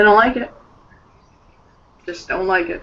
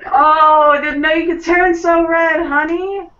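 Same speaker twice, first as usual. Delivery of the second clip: gleeful teasing; 9 semitones higher, 3 dB louder than usual. Acted. Teasing him for blushing.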